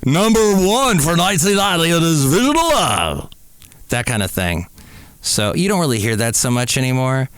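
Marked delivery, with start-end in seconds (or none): 0.1-3.3 puking radio DJ. Shown as a demonstration. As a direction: push every word like a pumped-up old-school DJ. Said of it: "That's what we call when people talk like this".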